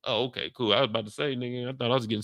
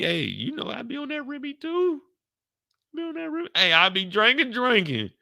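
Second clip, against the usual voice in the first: much higher squeaky excitement; mock falsetto hype; 12 semitones higher than usual. Bragging about drinking Remy, giddy and much higher. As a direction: jump way up into a giddy high voice.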